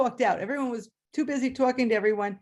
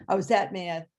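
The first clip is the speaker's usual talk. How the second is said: usual speaking voice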